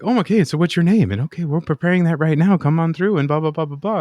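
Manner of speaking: high, loud overly sweet service voice; chipper server. Retold or imitated. Mimicking the overly nice drive-through staff, high and loud. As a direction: gush like a peppy fast-food worker. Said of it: "so nice".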